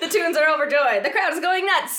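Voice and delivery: high, loud, ecstatic sports call; excited announcer. Shown as a demonstration. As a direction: scream the winning shot like a commentator. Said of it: "I'm gonna try and do my announcer voice".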